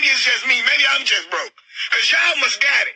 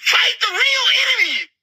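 raw, tearful shout, pitched up high; crying shout; 10 semitones higher than usual. Real, not acted. Woman ranting to viewers, crying out through tears. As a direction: cry out the words through tears.

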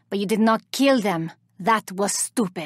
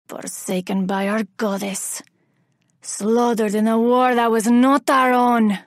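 normal talking voice

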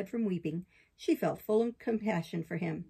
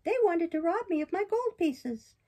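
high, bright-eyed puppet, much higher than the narrator; puppet boy voice; 10 semitones higher, 3 dB louder than usual. Acted. Pinocchio protests to the fairy in a high boyish voice. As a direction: play Pinocchio as an eager young boy defending himself.

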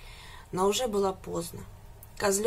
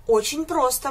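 a bit higher, lively exclamation; exclaiming; 5 semitones higher, 5 dB louder than usual. A narrator's reading. Goat answers the pig, lively exclaiming. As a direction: chirp it out eagerly. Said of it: "воскликнул козлёнок".

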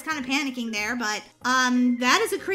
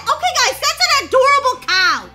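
high and loud, bursting with delight; gushing excitement; 9 semitones higher, 10 dB louder than usual. Real, not acted. Player gushing to viewers over her adorable cow, excited. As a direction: gush over it like it's the cutest thing ever.

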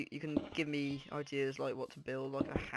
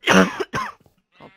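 cracked squeak on God; voice cracking; 23 dB louder than usual. Real, not acted. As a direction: voice breaks high. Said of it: "blame morning voice".

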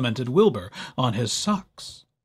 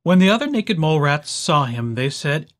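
plain reading voice

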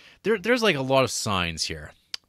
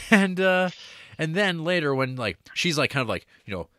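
ordinary talk

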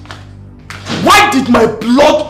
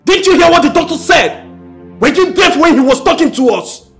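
angry confrontation; 4 semitones higher than usual. Acted. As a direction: demand the truth from his wife. Husband confronting wife over blood test.

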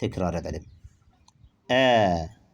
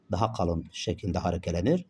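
ordinary talk, nothing marked

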